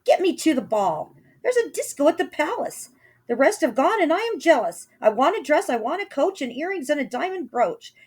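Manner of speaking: high, loud spoilt demand; high whiny girl shouting her wish list. A narrator's reading. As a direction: stamp your foot and demand it.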